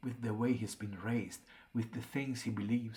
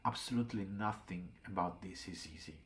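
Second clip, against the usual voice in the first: plain reading voice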